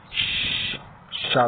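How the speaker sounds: long hushing "ش" released into "شا"; airy sh sound. Shown as a demonstration. Teacher showing learners the letter sheen, airy hushing "شا". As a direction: fill "شا" with air under the tongue. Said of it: "اس میں ہوا بھرنی ہے".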